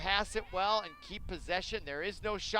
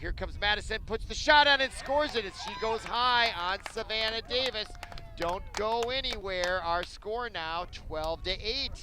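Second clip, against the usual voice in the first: louder, higher goal call; excited goal call; 5 semitones higher, 6 dB louder than usual. Acted. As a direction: shout the score as it goes in.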